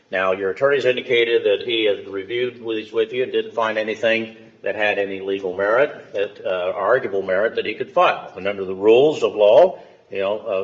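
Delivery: ordinary delivery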